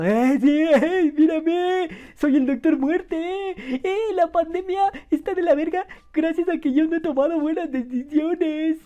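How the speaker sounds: high, loud, slow, goofy caricature; mocking Gatell. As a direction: play him as a smug idiot. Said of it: "el Gatell".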